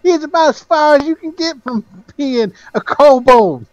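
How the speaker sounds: high-pitched exasperated protest; indignant objection. Acted. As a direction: argue it in a high, incredulous voice. Game master plays objecting character.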